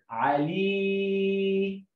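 stretched teaching demo of علي, sustained on the ii; syllable sounding-out. Shown as a demonstration. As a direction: hold the last vowel of علي very long and level. (نطق علي بمد طويل للياء)

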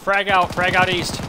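loud urgent grenade warning; shouted callout. Real, not acted. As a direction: yell it across the field.

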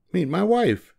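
high, perky little bird; proud bird. A narrator's reading. Bird presenting his wife to Thidwick, high and chirpy. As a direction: make a bright introduction up high.

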